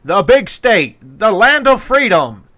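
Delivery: loud, high, stretched-out build-up; booming, drawn-out hype introduction; ring announcer. Acted. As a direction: bellow each phrase like a fight MC, dragging the last words.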